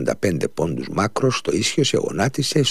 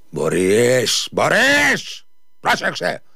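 higher, louder commanding shout; 7 semitones higher, 3 dB louder, much slower than usual. A narrator's reading. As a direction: bark it down at the shoemaker like a master. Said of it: "του φώναξε με δυνατή φωνή". (δυνατή, επιτακτική φωνή)

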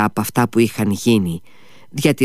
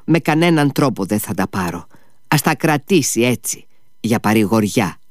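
plain reading voice

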